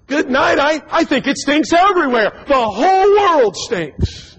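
high, drawn-out whine of disgust; exasperated disgust. Retold or imitated. Man walking the street, despairing at the stink. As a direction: despair at the smell of it all. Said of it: "and he goes".

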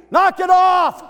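high loud mock yell; dad's shout. Dad yelling at the kids from the driver's seat. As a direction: bark it back over your shoulder.

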